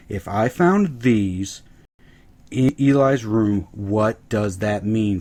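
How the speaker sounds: slow, deliberate hinting; drawn-out, pointed words, as if spoon-feeding; leading question. Retold or imitated. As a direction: lay out each clue slowly.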